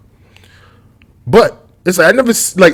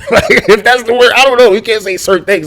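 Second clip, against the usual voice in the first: laughing; 9 semitones higher, 7 dB louder than usual. Real, not acted. Laughing at his own wrong word.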